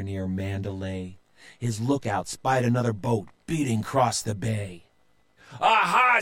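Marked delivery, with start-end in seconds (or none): 5.6-6.2 high, loud pirate cry; a gleeful pirate captain spotting prey; Dirty Joe. A narrator's reading. Pirate captain Joe crowing to his crew. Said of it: "said Joe".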